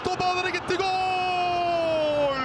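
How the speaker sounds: shouted goal call; screaming football commentator. Real, not acted. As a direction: yell the goal as it goes in.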